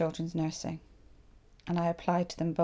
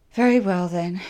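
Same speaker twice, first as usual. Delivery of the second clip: a clipped, much louder "very well then"; cool agency woman; 12 dB louder than usual. Retold or imitated. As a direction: voice the agency woman's loud, dismissive reply. Agency woman tilts her head.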